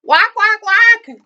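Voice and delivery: high, loud excited goose quacks; loud, high-pitched happy quacking; happy shouting. A narrator's reading. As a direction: squawk it out joyfully like a goose. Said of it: "she shouted happily".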